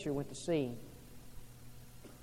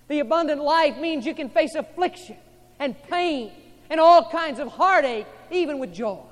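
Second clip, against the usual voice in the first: louder, higher, driving sermon shout; full-force preaching; 7 semitones higher, 15 dB louder than usual. Acted. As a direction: preach it out with fire to the congregation.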